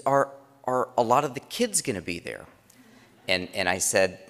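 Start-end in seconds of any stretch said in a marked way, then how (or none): 0.0-2.5 put-on higher, hopeful girl's question; eager daughter's question. Dad imitating his daughter asking about the kids. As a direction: lightly play the hopeful teen daughter. Daughter voiced in retold story.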